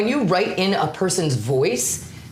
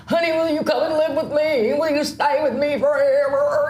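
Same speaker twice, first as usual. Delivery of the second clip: put-on smitten old patient; 7 semitones higher than usual.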